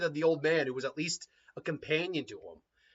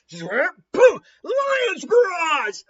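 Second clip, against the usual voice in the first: attack shout; 10 dB louder, much slower than usual. Acted. Fight attack called out.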